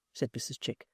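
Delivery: usual speaking voice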